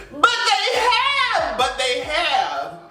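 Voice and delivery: high, drawn-out wail easing down; slow, helplessly tickled disbelief; incredulous amusement. Real, not acted. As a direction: stretch the comeback out through laughter. Laughing rebuttal to quoted apology.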